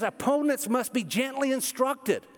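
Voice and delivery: high, drawn-out preacher's holler; screaming at listeners. Real, not acted. Pastor hollering at his own congregation about gentleness, near scream. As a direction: preach it high and forceful, stretching the words. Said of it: "like I'm doing at you right now".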